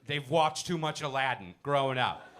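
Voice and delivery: normal talking voice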